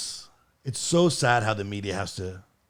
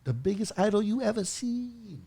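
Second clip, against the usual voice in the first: mock Chinese accent; 10 semitones higher, 4 dB quieter than usual. Shown as a demonstration. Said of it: "What I used to do".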